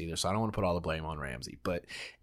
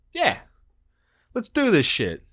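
loud, high hype-man energy; hyped up; 7 semitones higher, 6 dB louder than usual. Real, not acted. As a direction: pump up the crowd. Hyping up the show opening.

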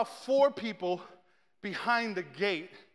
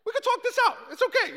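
much higher, squeaky nervous pleading; scared high voice; 12 semitones higher, faster than usual. Retold or imitated. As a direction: plead with a voice cracked high by fear. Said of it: "when your voice gets high-pitched".

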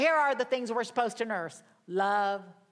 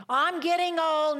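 mock complaint; 7 semitones higher, 6 dB louder than usual. Voicing a doubter's complaint.

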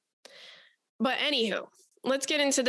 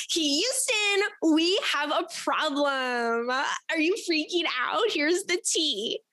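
higher, slow, drawn-out mock space call; mock astronaut radio call; 4 semitones higher, much slower than usual. Host pretends to radio Houston about the listener's tea, slow mock astronaut call. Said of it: "nasa would fire me".

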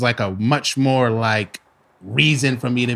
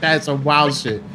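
ordinary delivery

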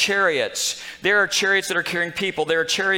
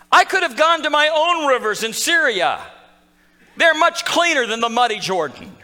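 enraged; 9 semitones higher, 5 dB louder than usual. Retold or imitated. Preacher voicing enraged Naaman protesting the Jordan, loud and high. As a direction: rant at having to dip in a muddy river. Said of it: "becomes enraged".

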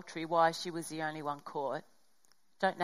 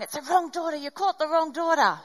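indignant protest; 8 semitones higher, 5 dB louder than usual. Retold or imitated. Inner protest at the wrong daughter.

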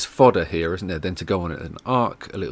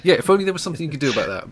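quick, higher, dry sarcasm; sarcastic; 8 semitones higher, 4 dB louder, much faster than usual. Real, not acted. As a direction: rattle off the mock sympathy. Sarcastic reply to complaint.

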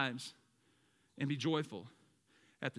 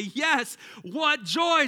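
higher and louder, shouted "what joy"; joyful shout; 8 semitones higher, 8 dB louder, much slower than usual. Acted. Preacher shouts scripture.

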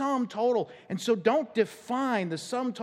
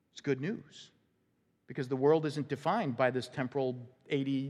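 ordinary delivery

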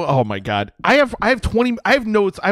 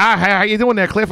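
bad Maine accent; 4 semitones higher than usual. Imitating the mom greeting Matheson in a bad Maine accent. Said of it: "what we just did is the accent".

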